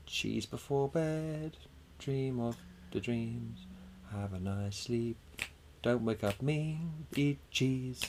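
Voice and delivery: soft, slow, drawn-out dreamy sponsor jingle voice; mock sleep advert. Host spoofs a cheese industry sponsor message, hushed and slow. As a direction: lull them softly and slowly, like a spoof bedtime ad.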